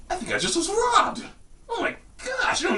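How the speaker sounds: very high, squeaky teen impression; young dude's changing voice. Realizing he just was robbed, mock squeaky adolescent voice. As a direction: push way up like a voice still changing. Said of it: "the young dude voice, who's like, you know, still changing".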